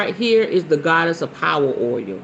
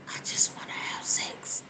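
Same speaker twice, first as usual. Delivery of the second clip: breathy whisper, much softer; whispered confession; 15 dB quieter than usual. Real, not acted. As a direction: lean in and whisper it like a secret. Confessing secret wish to viewers.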